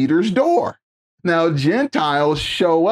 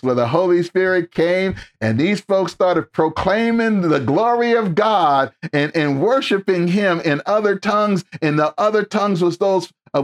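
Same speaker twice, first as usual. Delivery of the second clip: ordinary talk, nothing marked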